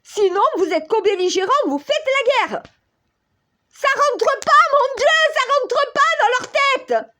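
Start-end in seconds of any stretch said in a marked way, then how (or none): 1.7-7.1 exasperated outburst. Real, not acted.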